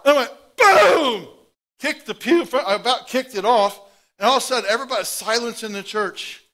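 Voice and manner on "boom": shouted higher, louder boom. Retold or imitated. Boy startled awake kicking the pew, loud shouted boom. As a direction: bark the crash of a kicked pew.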